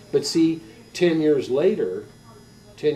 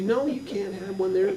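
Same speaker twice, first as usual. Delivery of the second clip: lightly voiced high mother; pitched-up mock motherly no; refusing mom; 7 semitones higher than usual. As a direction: turn the kid down like a mom. Mother refusing child again.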